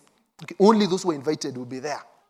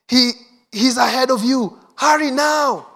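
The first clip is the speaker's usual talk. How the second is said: put-on breathless urgent young women, pitched higher; 6 semitones higher, 5 dB louder than usual. Young women urging the travellers on, with urgency. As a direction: rush the words, pressing urgency. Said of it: "I was trying to just show you the intensity".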